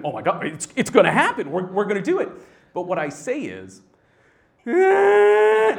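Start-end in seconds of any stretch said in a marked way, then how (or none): 0.0-2.3 excited inner voice, high and loud; screaming thoughts. Retold or imitated. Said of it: "my thoughts are screaming". 4.6-5.8 very high, loud crying wail; drawn-out squealed cry. Retold or imitated. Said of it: "and it's loud".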